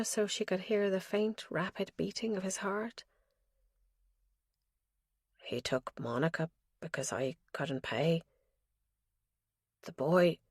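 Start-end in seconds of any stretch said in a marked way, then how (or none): 5.4-10.4 put-on lower, softer old father's murmur; a lightly voiced frail old father mumbling; fading mumble. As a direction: let it drift away half-asleep. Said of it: "His voice faded into a mumble".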